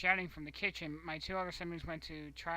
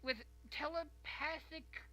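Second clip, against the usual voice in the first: over-the-top mock mother insisting it was them; mocking excuse voice; 9 semitones higher than usual. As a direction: whine like a guilty mom blaming everyone else. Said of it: "Like, some".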